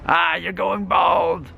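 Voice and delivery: slow, high villain jeer; hammed-up villain taunt, pitched high. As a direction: draw out a mocking shout like a movie villain. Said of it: "villains around him like".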